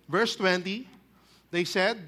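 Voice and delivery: ordinary delivery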